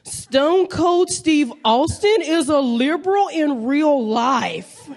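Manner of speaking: slow, drawn-out mock know-it-all correction. Young man correcting a comic after an open mic, slow put-on voice. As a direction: put on a young guy slowly setting her straight.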